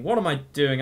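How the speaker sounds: ordinary talk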